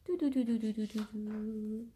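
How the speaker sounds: fading suspense hum. Acted. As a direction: hum the suspense and let it fade away. Suspense hum before page.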